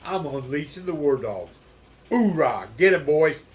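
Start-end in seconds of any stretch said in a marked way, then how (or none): 2.1-3.4 booming military battle cry; loud gung-ho soldier. Pretend. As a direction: yell it like a drill sergeant. Unleashing the war dogs.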